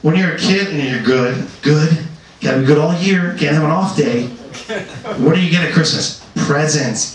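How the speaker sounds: ordinary delivery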